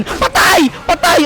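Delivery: high, sudden exclamations; panicked yelps. Real, not acted. Player sure the character is done for, panicking. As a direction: yelp it in alarm.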